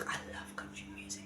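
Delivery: unvoiced whispered aside. Real, not acted. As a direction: whisper it to yourself. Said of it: "um, I love country music".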